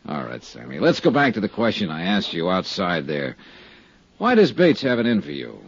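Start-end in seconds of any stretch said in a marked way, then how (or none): 0.0-0.8 laughing. Acted. Amused reply during interrogation.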